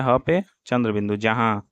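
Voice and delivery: ordinary talk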